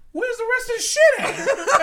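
very high, loud mom impression; hammed-up high-pitched demand; mock outraged shriek. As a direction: screech it like a cheated mother. Imitating mom's outrage at the gift.

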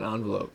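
"envelope" starting AHN, like "on"; ON pronunciation. Shown as a demonstration. Puzzling over the other variant, "envelope" opening with ON. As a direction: say "envelope" as ON-velope. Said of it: "I never understood envelope".

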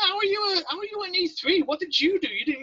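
high-pitched indignant questioning; mock incredulity. Recruits questioning his E three stripes, mock incredulous. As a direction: protest it high, in disbelief.

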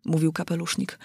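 plain reading voice

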